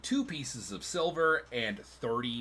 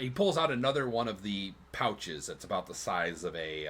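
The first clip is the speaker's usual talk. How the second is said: ordinary delivery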